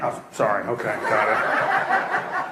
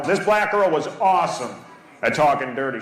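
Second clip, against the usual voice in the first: normal talking voice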